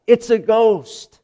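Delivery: drawn-out, high cry of alarm, acted; mock terrified cry. Disciples crying out at a figure on the water, mock terrified. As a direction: cry it out high and stretched, imitating panic. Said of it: "they cry out".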